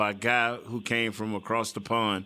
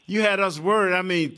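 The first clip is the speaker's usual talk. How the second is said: normal talking voice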